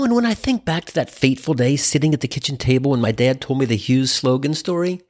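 normal talking voice

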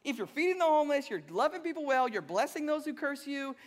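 loud, high-pitched sarcastic challenge. Retold or imitated. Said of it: "How do you not hear that sarcasm?".

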